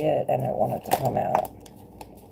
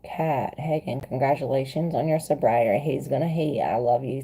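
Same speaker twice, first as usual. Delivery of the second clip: ordinary delivery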